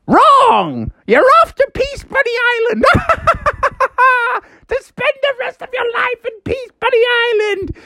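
very high cackling villain; gloating talking house. Acted. House banishes Froggy for a wrong answer, high gloating cackle. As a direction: crow the verdict, breaking into a cackle. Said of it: "the guy was like".

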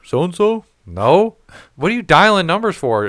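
high, exasperated back-and-forth; mock annoyed caller exchange. Fielding a wrong-number call, high and exasperated. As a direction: play both sides, irritated.

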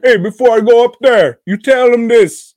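high, fast shouted impression; loud mock accent. Host mimicking a comedian's shtick, loud mock accent.

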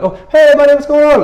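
high, loud, hammed-up hello; suddenly delighted to spot a buddy; gushing greeting. Distracted listener greets someone else.

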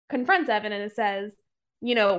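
normal talking voice

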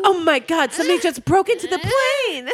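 high, loud, fast panic; panicked cry. Acted. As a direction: scream it out in sudden alarm. Character interrupted by break-in.

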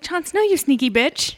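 sassy mock outrage. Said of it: "but I was like".

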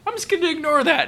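airy brush-off in a high voice; high dismissive quote. Retold or imitated. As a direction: wave it away, up high.

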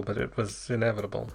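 ordinary delivery

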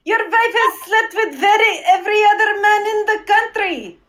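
a teasing Russian lady; Russian accent. Acted. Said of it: "you sound a little Russian to me".

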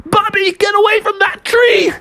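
high, shouted alarm; panicked warning. Acted. Junior Bear warns Bubby about the living tree, panicked. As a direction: yell a frantic warning to a friend.

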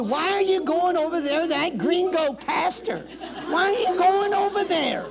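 mock the doubters with a laugh; mocking scornful voice. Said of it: "The world tells you".

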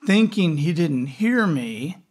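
usual speaking voice

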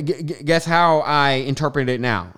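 ordinary talk, nothing marked

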